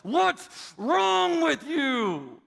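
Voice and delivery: slow, high, loud angry yell. Retold or imitated. Daughter yells in her sister's face, angry. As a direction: snap it at your sister, slowed and loud. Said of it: "and she yelled".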